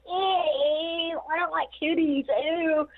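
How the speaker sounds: high, squeamish mock disgust; disgusted Pamela Anderson. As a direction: squeal it out as a grossed-out starlet. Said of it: "And she's like".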